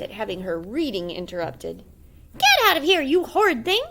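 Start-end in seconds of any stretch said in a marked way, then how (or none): 2.4-3.8 put-on high, loud angry old woman; furious granny. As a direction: drive him out with fury. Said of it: "she cried".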